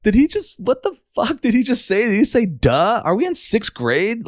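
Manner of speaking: retelling his own stunned reaction to a coworker; shocked disbelief. Retold or imitated. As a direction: squeak it out, outraged and incredulous. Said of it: "I was like".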